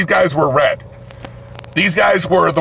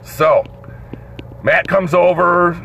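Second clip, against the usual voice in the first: plain reading voice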